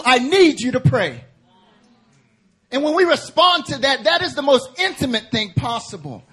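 high, loud, urgent preaching of God's plea; full-force preaching. Acted. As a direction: preach it as a cry to the congregation.

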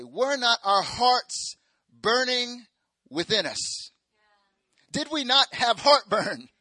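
high, loud and slow, hammed-up; dramatic mock disciples. Disciples asking each other, loud hammed-up wonder. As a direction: overplay the amazed disciples for laughs.

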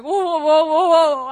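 loud, panicky string of whoas; panicked whoa. Retold or imitated. Rider about to hit little kids, loud and panicked. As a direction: retell a panicked cry at full voice. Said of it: "I didn't know what to do".

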